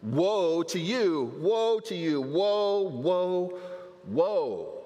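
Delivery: rising prophetic woes; building woes. Acted. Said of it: "he lets it fly".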